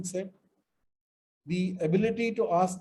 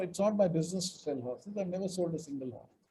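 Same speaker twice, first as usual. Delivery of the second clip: ordinary delivery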